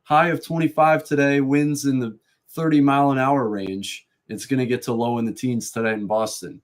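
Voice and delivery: imitated radio weatherman delivery; radio weather report. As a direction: toss to weather, then read the forecast up high. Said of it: "They toss to him".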